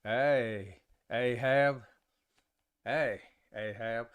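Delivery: low Southern drawl; Southern accent. Shown as a demonstration. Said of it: "I could do it the Southern way".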